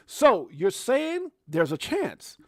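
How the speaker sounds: slow, drawn-out movie-line reading; slow, playful quoting of the film line; mock movie quote. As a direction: savor the famous line slowly.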